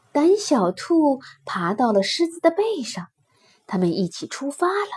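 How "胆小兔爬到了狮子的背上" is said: ordinary delivery, nothing marked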